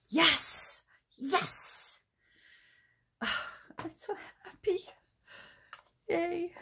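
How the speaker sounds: squealing with delight; overjoyed, breathy high cheers; excited. Real, not acted. Thrilled at result of stencil.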